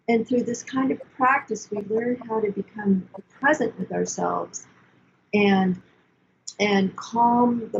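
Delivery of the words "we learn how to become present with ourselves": ordinary delivery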